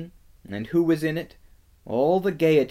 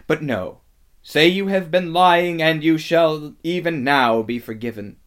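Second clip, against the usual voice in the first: raised, forceful witch offering terms; imperious demand; 5 semitones higher, 8 dB louder than usual. A narrator's reading. Witch offers forgiveness for a retraction, imperious demand. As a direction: press them to recant.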